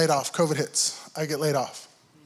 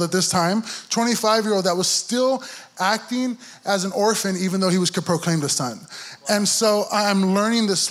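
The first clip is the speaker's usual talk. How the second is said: impassioned preaching; 4 semitones higher than usual. Acted. Pastor lifting the congregation, impassioned preaching. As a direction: preach it out as the music rises.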